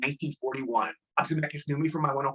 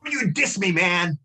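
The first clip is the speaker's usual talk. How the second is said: high, loud mock threat; scary gang member; 3 semitones higher, 4 dB louder, slower than usual. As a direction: imitate a menacing gangbanger confronting someone. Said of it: "He said it in the scariest voice".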